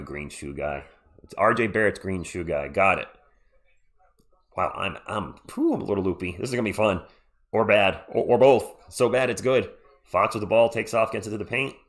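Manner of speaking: ordinary delivery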